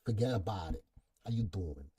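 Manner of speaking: put-on low, soft Italian Brooklyn accent; playful Italian Brooklyn accent, voice dropped low. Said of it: "Say it in your Italian Brooklyn accent".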